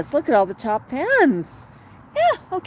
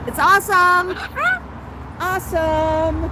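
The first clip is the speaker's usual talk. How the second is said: mimicking the bird; 7 semitones higher, 4 dB louder than usual. Echoing the bird's word.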